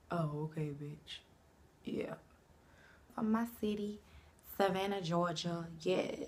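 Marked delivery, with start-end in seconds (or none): none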